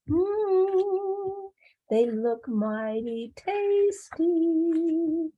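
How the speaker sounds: high, drawn-out savouring voice; hungry leopard. Acted. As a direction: linger slowly over a delicious prospect. Leopard eyes rabbits as food.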